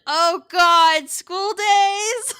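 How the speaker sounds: loud, high cry breaking into laughter; laughing, appalled shout; shocked outburst. Real, not acted. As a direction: blurt it out in amused horror. Startled by mention of School Days.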